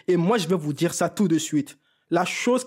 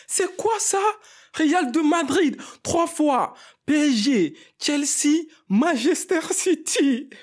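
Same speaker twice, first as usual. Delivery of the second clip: much higher, slow, stunned listing of the clubs; mock-incredulous, as if he can't believe the list; stunned disbelief; 8 semitones higher, slower than usual. Fan reliving his disbelief at the comeback, high and stunned. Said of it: "Tu te dis".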